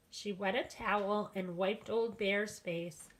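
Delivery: ordinary delivery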